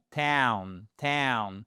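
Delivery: TOWN with a gliding ow, as in now; correct English diphthong. Shown as a demonstration. English teacher corrects learners on town, careful model pronunciation. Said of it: "Et pas tone".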